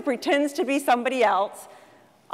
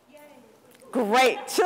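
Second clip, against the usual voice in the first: ordinary talk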